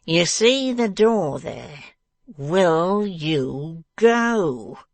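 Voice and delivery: slow, forceful, furious dwarf; bellowing tyrant throwing someone out; energetic command. A narrator's reading. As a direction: rant slowly, building to a loud drawn-out order. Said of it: "delivered this last command in such a very energetic voice".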